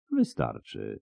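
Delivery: low, soft and composed; low calm voice; calm interjection. A narrator's reading. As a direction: quietly put an end to it. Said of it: "powiedział wampir".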